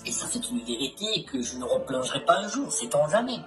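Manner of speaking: anonymized low distorted voice; disguised voice. Real, not acted. Anonymized interviewee testimony.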